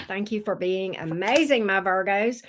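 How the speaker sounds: ordinary delivery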